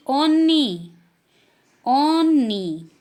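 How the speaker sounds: teacher modelling Korean "ओन्नी"; Korean word. Shown as a demonstration. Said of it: "तो कैसे बोलेगी".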